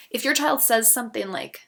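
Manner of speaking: ordinary talk, nothing marked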